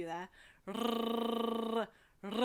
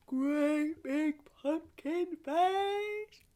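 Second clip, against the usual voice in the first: pumpkin face voice; 7 semitones higher, much slower than usual. Shown as a demonstration. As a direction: stretch every word out huge.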